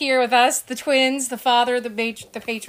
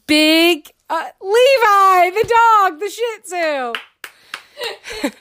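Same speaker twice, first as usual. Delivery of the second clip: loud, high, drawn-out fanfare; mock guest announcement; 5 semitones higher, 3 dB louder, slower than usual. Host presents the dog as a special guest, hammed-up announcer.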